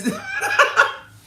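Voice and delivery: shrieking laugh; real laugh at her own impression; high squealing laugh. Real, not acted.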